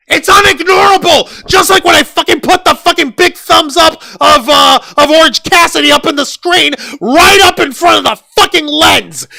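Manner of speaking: loud, high, exasperated yelling; furious shout. Real, not acted. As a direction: blast it at the audience. Furious rant about Orange Cassidy.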